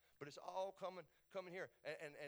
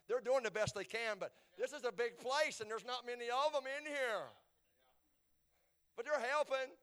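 high, loud pulpit voice; raised preacher's shout; full-force preaching; 9 semitones higher, 9 dB louder than usual. Real, not acted. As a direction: call it out over the congregation.

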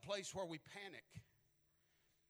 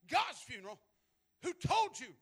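a flash of angry disbelief, rising high and louder on the question; retold anger; 10 semitones higher, 9 dB louder than usual. Luther snapping at his wife in sudden anger. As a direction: bristle at the wife's words. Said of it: "he got mad".

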